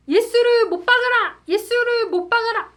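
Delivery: loud, high-pitched mob chant; rioting crowd shout. Acted. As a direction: shout it out to stir up an uproar. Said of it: "이렇게 소동을 일으키자". (소동 일으키는 군중처럼 크게 외치기)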